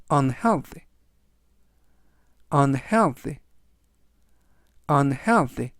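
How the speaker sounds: unhealthy repeated clearly, falling; model pronunciation. Shown as a demonstration. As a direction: say unhealthy clearly with a fall for learners. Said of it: "라고 발음하시면 되겠고요".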